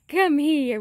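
firm call, come here; calling the dog. Real, not acted. Calling puppy back.

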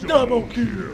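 loud, hyped shout; shouted callout. Real, not acted. Player yells the kill callout to his friend, loud and hyped. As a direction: yell it like a game announcer. Gamer calls out a double kill.

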